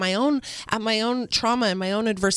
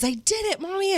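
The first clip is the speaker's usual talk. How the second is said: high-pitched imitation of a delighted child; elated little boy; 10 semitones higher than usual. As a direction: voice a small child's triumph, much higher. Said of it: "you could see how elated he was".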